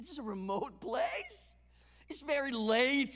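drawn-out hint, lilting up on "place"; sugar-coat the complaint, slowly; polite hinting with a questioning lift; nice-sounding hint. Acted. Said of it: "It sounds so nice".

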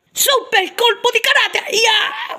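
hammed-up squirrel karate yell; karate shout. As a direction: yell the karate move with a whoop. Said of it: "Sono Sandy io".

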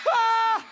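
loud high startled cry; alarmed yell. Acted. Bobby panicking by the leaking barrel, loud cry. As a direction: let out a sharp scared yell.

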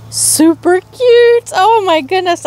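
high, squealing delight; excited squeal. Real, not acted. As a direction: gush over the cute charm. Reacting to locket charm.

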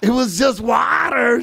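slow, drawn-out mock cry; hammed-up tearful insistence; mock wailing. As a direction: wail it slowly, stretching the last word. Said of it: "then he starts saying".

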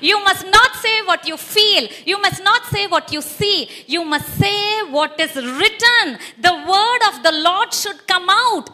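loud, high preaching shout; fiery preacher's cry; preaching at full force. Real, not acted. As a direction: proclaim it to the whole church.

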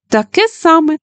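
high excited exclaim. Acted. Judy exclaims to Frank about matching colors, excited. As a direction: shout it out, thrilled. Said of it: "вигукнула Джуді".

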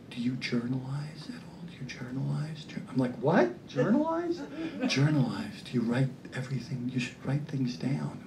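low, soft soothing murmur; quiet therapist voice. Retold or imitated. Comedian voices the therapist calming him down. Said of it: "he sat there like this".

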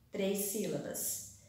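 ordinary talk

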